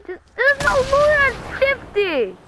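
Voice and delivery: high, slow exasperated cry; frustrated shout. Real, not acted. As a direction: yell it out in disbelief. Frustrated outburst in game.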